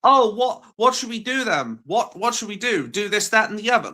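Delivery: high mocking whine of critics; whiny critics. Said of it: "there are gonna be people like".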